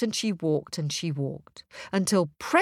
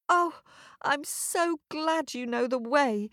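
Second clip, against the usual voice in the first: put-on higher, delighted girl's voice; light up with relief; glad girl; 6 semitones higher than usual. Girl thanking the North Wind, high and delighted.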